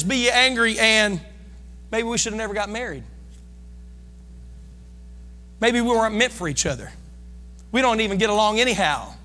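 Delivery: bitter spouse. Retold or imitated.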